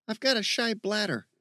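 high-pitched jokey call. Acted. Steve joking to his friend, calling out. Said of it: "Steve called out".

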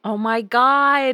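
loud, slow, stunned cry; shocked exclamation. Real, not acted. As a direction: let the shock burst out. Reaction to boy walking up wall.

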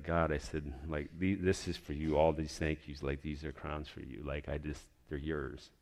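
quiet, intimate thanks to God; hushed prayer. Retold or imitated. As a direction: pray it softly, alone on your knees. Alone on knees praying.

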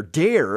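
"dare" punched out firmly and falling; stressed word. Shown as a demonstration. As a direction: lean hard on "dare". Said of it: "a serious verb to place stress on this word".

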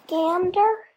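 plain reading voice

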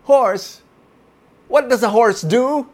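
high, loud coaxing bird. A narrator's reading. Bird coaxes the horse toward the answer. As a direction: lead him to the answer. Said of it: "the bird said to the horse".

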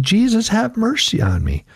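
high, pleading cry. A narrator's reading. Blind beggar crying out to Jesus, high and pleading. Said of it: "And he cried out".

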